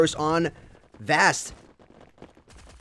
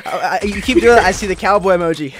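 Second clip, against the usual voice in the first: loud, high, amused teasing; cracking up while ribbing a co-caster; laughing tease; 4 semitones higher, 10 dB louder than usual. Real, not acted. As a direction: tease your partner through a laugh. Teasing co-caster about his accent.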